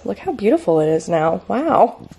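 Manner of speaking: normal talking voice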